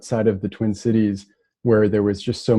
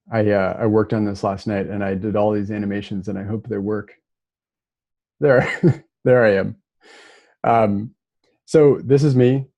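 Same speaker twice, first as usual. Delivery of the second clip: ordinary talk, nothing marked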